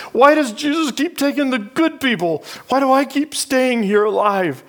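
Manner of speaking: put-on a lost boy crying out to the dark; grieving child's thought. Boy in his cot questions God, pained and high.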